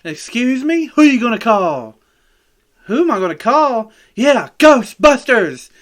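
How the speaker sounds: mock prank call rising to a big finish; cheesy prank call. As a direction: ham up the prank caller's punchline. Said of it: "call somebody and be like".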